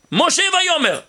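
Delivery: ordinary talk, nothing marked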